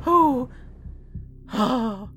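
high-pitched fussy shop lady; Mina Harker. Pretend. Charity shop worker sorting stock alone, high put-on voice. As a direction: potter about in a put-on lady's voice.

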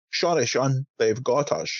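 slurred-S Scottish accent imitation; Sean Connery. Shown as a demonstration. Showing the line as it should sound, Scottish accent with shushed S. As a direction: put on Scottish with "sh" for S. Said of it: "like, a Sean Connery accent to say".

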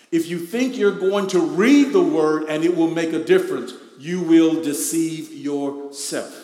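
loud, driving preaching; fervent sermon delivery. Acted. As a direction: hammer the warning home. Preaching at full force.

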